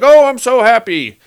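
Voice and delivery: high, loud hammed-up joy; sarcastic overjoyed gush; mock delight. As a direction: fake gushing happiness, pitched high and loud. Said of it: "And Robert's like".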